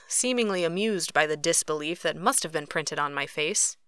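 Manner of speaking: plain reading voice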